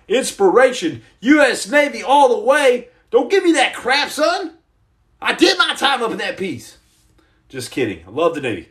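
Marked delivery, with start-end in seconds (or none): 1.2-6.8 pitched-up, playful outrage; hammed-up scolding, climbing high; mock indignation. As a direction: pretend to be offended and protest. Mock indignation, then "Just kidding.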